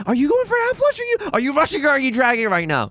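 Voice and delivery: high, loud disbelief; incredulous. Real, not acted. Player yelling at opponent Leftwood, high incredulous shout. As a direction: shriek it at the opponent.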